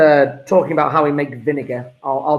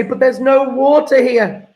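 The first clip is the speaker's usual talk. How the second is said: higher, slower mock-confused student; a hint of a puzzled pupil, pitched up and slowed; 8 semitones higher, 4 dB louder than usual. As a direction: echo a baffled student's protest. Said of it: "Students often say".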